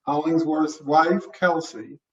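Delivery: usual speaking voice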